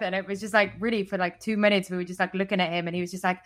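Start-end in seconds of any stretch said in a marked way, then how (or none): none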